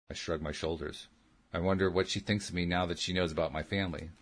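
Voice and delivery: ordinary delivery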